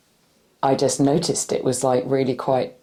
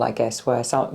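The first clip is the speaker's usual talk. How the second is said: ordinary delivery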